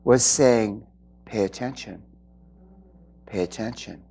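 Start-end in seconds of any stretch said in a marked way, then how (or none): 1.3-4.0 low, hushed, soft-spoken urging; soft inner voice. Retold or imitated. The speaker voices her guides' hushed inner voice. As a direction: murmur it like a gentle inner voice. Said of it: "this other voice in the background was saying".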